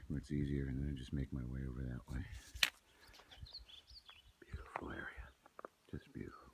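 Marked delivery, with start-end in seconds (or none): 4.4-6.5 hushed, awed whisper. Real, not acted. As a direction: breathe it out in awe. Admiring view, hushed.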